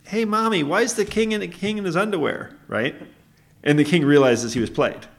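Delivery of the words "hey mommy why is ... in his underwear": put-on high-pitched child calling to mom; high, innocent kid voice; little kid. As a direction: ask it as a puzzled child, pitched way up. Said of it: "a kid says".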